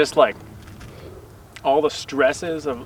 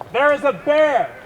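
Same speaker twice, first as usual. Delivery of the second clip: high, slow frightened call; urgent cry about the bear; alarmed shout; 12 semitones higher, 5 dB louder, slower than usual. Real, not acted. Warning of a bear.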